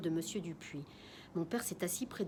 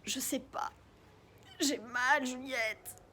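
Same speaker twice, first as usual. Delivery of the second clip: put-on breathy, pained old man; weak pained voice; 4 semitones higher, slower than usual. Sick neighbour telling Juliette he hurts, weak and pained. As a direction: struggle to get the words out through the pain.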